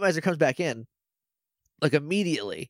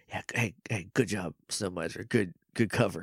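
lower, softer sarcastic praise; sly low aside; 7 semitones lower, 6 dB quieter than usual. Retold or imitated. Mac mocking Snow Miser from his cage, low and sly. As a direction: murmur it like a smug prisoner.

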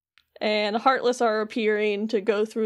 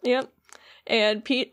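ordinary delivery

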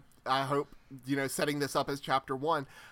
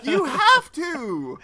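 excited; 11 semitones higher, 10 dB louder, much slower than usual. Real, not acted. Urging sheep sound demo.

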